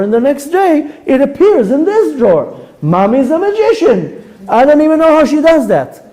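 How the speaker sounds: put-on a kid who thinks Mommy does magic; amazed child. Child marvelling that clean laundry appears, high amazed voice.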